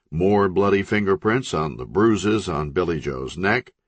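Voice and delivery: usual speaking voice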